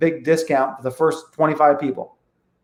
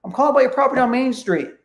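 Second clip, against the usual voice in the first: higher, quick and easy, street name only; natural and casual; 7 semitones higher, faster than usual. Shown as a demonstration. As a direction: toss it off like it's nothing. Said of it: "Only thing you give is the street name only".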